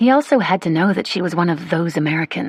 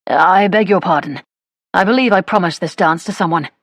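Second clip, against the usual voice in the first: louder, put-on gentleman voice; gentleman's brush-off; 4 dB louder than usual. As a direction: make a polite excuse as a society gentleman. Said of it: "the gentleman would say".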